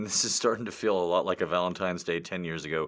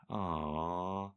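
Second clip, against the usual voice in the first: mock sad aw; 2 semitones lower, 7 dB quieter than usual.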